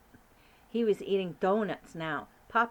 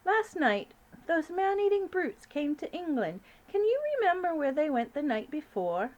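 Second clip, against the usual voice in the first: high, regal, louder queen voice; 9 semitones higher, 5 dB louder than usual. Acted. Queen calling up to the giant, regal and high. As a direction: speak as a commanding queen.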